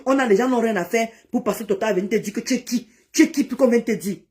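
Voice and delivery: ordinary delivery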